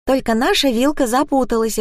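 put-on high-pitched little girl voice; young daughter explaining. A narrator's reading. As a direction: explain it earnestly like a small child. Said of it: "объяснила дочь".

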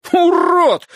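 high, loud roar. Acted. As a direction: bellow the insult. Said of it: "взревел он".

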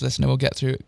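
plain reading voice

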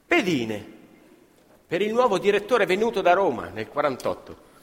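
ordinary talk, nothing marked

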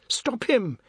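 high-pitched plea; urgent high appeal; alarmed cry. A narrator's reading. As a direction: cry out for someone to intervene. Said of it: "Chinta cried".

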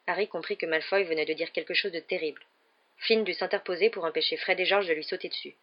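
plain reading voice

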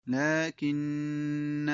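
لكن drawn out slowly at the stop. Shown as a demonstration. Teacher demonstrates the stop slowly. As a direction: stretch لكن at the pause. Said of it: "وقف".

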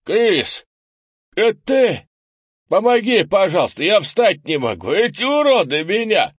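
very high, loud cries for help; desperate shout. A narrator's reading. Burned neighbour begs Chris for help, crying out. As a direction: call out in desperation. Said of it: "обгоревший мужчина из дома по соседству крикнул".

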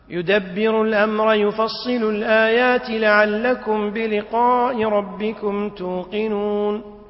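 slow chant, "الآيات" flowing into "لعلكم"; linked without stopping. Shown as a demonstration. Teacher demonstrates linking the verse, "الآيات لعلكم" in one breath. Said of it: "تصله بما قبله".

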